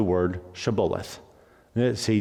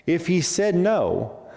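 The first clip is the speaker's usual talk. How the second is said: ordinary delivery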